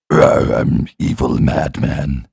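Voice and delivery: slow, low hammed-up madman voice; mock evil madman. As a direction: parody the cliché villain snarl. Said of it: "the classic, just mad voice".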